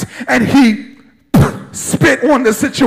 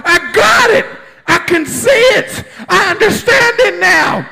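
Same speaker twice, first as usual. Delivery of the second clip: Peter's joyful recognition, raised voice; Peter's excited cry; 6 semitones higher than usual. Acted. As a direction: blurt it out like a man who finally sees. Peter's confession voiced in story.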